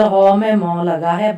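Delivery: ordinary talk, nothing marked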